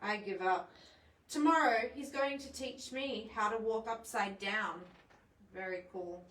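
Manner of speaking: ordinary delivery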